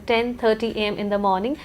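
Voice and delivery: ordinary talk, nothing marked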